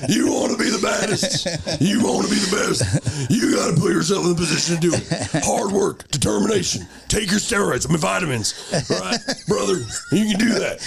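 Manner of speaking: WWE wrestler. Acted. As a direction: fire up the fans like a wrestler. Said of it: "W W E".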